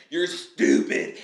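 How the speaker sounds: a slow, loud, breathy mock insult at the other driver; mock road rage. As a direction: spit it out slowly like a fed-up driver. Driver cuts us off, acted insult.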